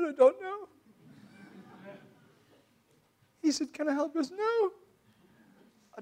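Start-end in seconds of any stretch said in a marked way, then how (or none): 0.0-4.7 tearful cry. Retold or imitated. Retold crying at altar call.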